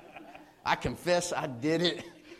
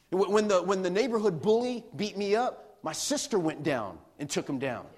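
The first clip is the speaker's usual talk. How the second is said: ordinary delivery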